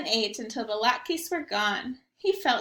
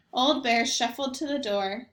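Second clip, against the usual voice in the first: ordinary delivery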